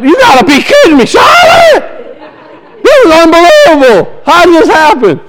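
high, laughing yell of disbelief; shocked shout. Retold or imitated. Dad shouting at Charlotte over the messy diaper. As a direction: cry out, half laughing, at the mess. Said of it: "I went".

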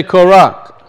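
"Korach" stressed on the second syllable; Hebrew pronunciation. Shown as a demonstration. Teacher has everybody repeat the name, Hebrew pronunciation. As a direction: say "Korach" as in Hebrew, weight on the end. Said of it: "So in Hebrew it's".

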